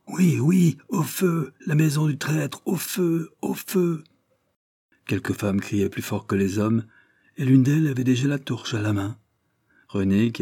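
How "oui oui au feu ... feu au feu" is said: high angry cries from the mob; angry crowd shouting. A narrator's reading. Fishermen rushing to burn the traitor's house, angry shouting. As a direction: yell it like a furious fisherman. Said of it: "en criant avec colère".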